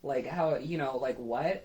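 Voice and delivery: indignant after everything done; irritated disbelief. Retold or imitated. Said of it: "now I'm irritated".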